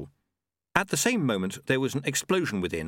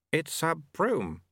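slow, drawn-out, higher voice; firm correction; 7 semitones higher, much slower than usual. Acted. Sweeper refusing a secret weapon, slow and firm. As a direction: spell it out slowly, weight on BROOM.

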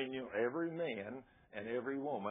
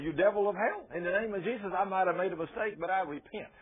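loud, higher bold rebuke; rebuking the devil; 9 semitones higher, 6 dB louder than usual. Pretend. Believer rebukes the devil, loud and bold. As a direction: command the devil away. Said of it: "You gotta say".

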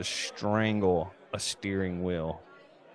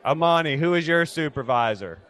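demanding supervisor; 7 semitones higher, 3 dB louder than usual. Pretend. Angry customer demands a supervisor.

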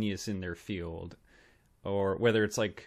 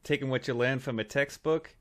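a hammed-up Boston bar guy, lightly done; mock Boston tough guy taunting a smart kid; Boston accent. Podcast host mocking the Good Will Hunting bar scene in Boston accent. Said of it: "I was losing the Boston there".

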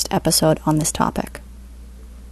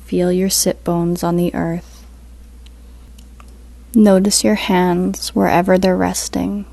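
guided meditation; 4 dB quieter than usual. Acted. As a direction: ease them into stillness, unhurried.

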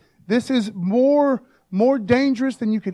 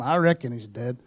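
put-on lower, worn old-man mumble of I reckon he's dead; mock old man, voice pitched down; old man in a nursing home; 8 semitones lower than usual. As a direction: play a tired old nursing home resident. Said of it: "like walking into the nursing home".

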